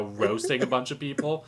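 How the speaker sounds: ordinary talk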